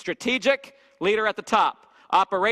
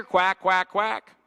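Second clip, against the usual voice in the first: louder quacking, tossed off; mock duck quacks; 4 dB louder, much slower than usual. Preacher mocking a passed-off customer, quacking loudly. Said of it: "it's a duck pond".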